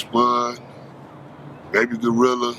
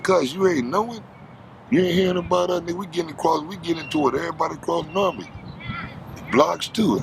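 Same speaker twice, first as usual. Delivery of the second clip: retold friend's warning; 6 semitones higher, faster than usual. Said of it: "He said".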